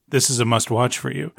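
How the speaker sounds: ordinary delivery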